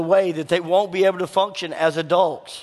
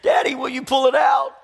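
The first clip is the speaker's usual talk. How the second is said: put-on pleading child; 6 semitones higher than usual. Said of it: "she goes".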